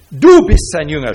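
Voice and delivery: loud retold accusing yell; shouting accusation. Pharisees yelling at the healed man, loud accusation. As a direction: hurl the accusation at him. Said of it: "schrien sie ihn an".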